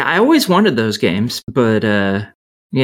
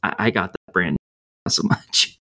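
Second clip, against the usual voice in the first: soft, low mumble; mumbling; 5 semitones lower, 6 dB quieter than usual. Real, not acted. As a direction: mumble it under your breath, trailing into near-whisper. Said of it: "Stop mumbling into the mic".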